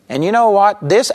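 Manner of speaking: usual speaking voice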